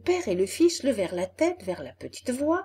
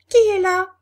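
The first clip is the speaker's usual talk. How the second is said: high, loud little voice calling; small voice; 10 semitones higher, 10 dB louder, faster than usual. A narrator's reading. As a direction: call out like a tiny creature. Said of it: "demanda une petite voix".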